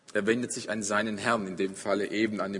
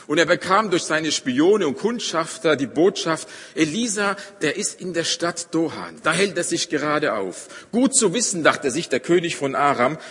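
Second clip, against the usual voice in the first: louder, higher storytelling from the pulpit; forceful preaching; 5 semitones higher, 6 dB louder than usual. Acted. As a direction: drive the Bible story home to the congregation. (kräftige, lautere Predigtstimme)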